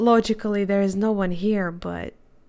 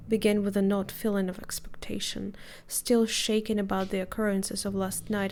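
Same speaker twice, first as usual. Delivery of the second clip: calm, even reading of the narration; story narration; faster than usual. Acted. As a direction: tell it like the opening of a chapter. Reads game narration.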